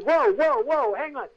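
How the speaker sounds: high, urgent retold protest; acted scramble to halt someone; alarmed protest. Actor to guard locking him up, alarmed protest. Actor resists being locked up.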